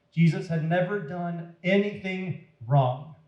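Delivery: ordinary delivery, nothing marked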